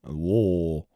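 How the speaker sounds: "Louisville" low and swallowed as "Luh-vul"; local pronunciation. Shown as a demonstration. Repeating the drill for chat: "Louisville" low, "Luh-vul". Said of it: "That's how you say it".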